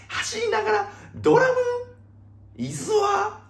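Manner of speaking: extremely high falsetto questions; squeaky disbelief. Pretend. Host baffled by drumming while running, squeaky disbelief. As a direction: squeak each word as a baffled query.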